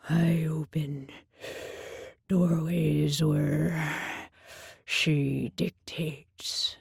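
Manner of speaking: low, slow, breathy beast voice; low slow Minotaur. A narrator's reading. Minotaur telling the girl what he does for her, low and slow. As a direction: drag the words out deep and slow. Minotaur explains his duty.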